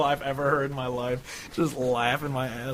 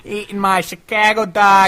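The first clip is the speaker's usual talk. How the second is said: loud, higher hammed-up Chicago accent; 8 semitones higher, 10 dB louder than usual. Proving to fans they are from Chicagoland: Chicago accent. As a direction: ham up the Chicago local. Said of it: "We can do the accent".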